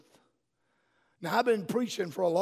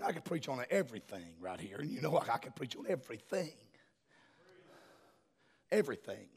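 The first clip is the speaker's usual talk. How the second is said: low, much softer aside, quickly dropped in; quiet aside; 7 semitones lower, 11 dB quieter, faster than usual. Real, not acted. Preacher confiding what he could preach on, hushed aside. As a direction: drop right down under the sermon's volume.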